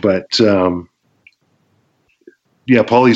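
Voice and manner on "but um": ordinary talk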